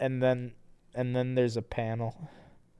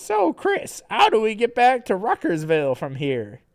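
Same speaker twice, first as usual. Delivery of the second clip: character voice; 10 semitones higher, 6 dB louder than usual. Acted. Reading Sarah's lines in voice.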